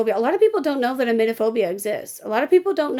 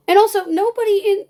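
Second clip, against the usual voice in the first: high, loud exasperated start; exasperated outburst; 9 semitones higher, 5 dB louder than usual. Real, not acted. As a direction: burst in, exasperated.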